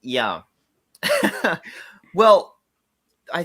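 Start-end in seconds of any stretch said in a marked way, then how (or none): 1.0-1.6 sudden high-pitched giggle; high laugh burst. Real, not acted. Laughing at blanking.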